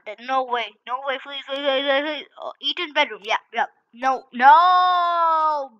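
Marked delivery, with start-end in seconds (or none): 3.9-5.7 loud, raised, drawn-out protest; dismayed cry. Real, not acted. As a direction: wail the last "no" long and high. Dismayed at game's map choice.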